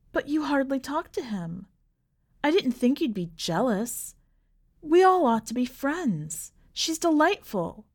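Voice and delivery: put-on a girl coaxing her mother; young princess voice. Princess defends new bride.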